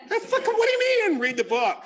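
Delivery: high, loud, laughing disbelief; incredulous. Retold or imitated. Newcomer stunned his sponsor skipped the book, incredulous. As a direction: blurt it out in shocked disbelief. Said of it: "disbelief at not reading the book".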